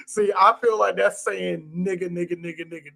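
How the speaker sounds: usual speaking voice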